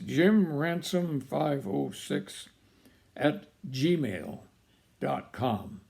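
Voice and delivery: slow and spelled out for note-taking; slow, clear dictation. Shown as a demonstration. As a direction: dictate each part slowly and clearly. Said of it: "Let me repeat it".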